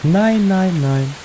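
high fearful protest; scared refusal. Real, not acted. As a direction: back away, refusing. Refusing to look in toilets.

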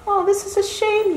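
a son gently pleading with his mother. Retold or imitated. Son pleading with his mother to let him go. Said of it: "I say".

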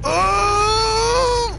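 high, long wail of effort; desperate cry. Real, not acted. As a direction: wail in frantic struggle. Straining to break the code.

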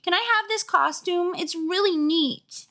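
put-on high, babyish begging; squeaky, drawn-out kid voice; little brother voice. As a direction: plead like a small boy. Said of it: "This is his voice".

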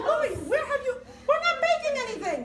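high, loud, laughing yell; exasperated shout. Retold or imitated. Wife yelling at husband over the fire, high laughing shout. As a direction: shout it at him through laughter. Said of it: "I go".

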